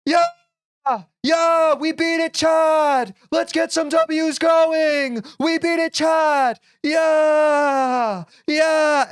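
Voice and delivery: loud, high celebratory yelling; hype yelling. Pretend. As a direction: fake hype shout for chat. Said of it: "I was just like".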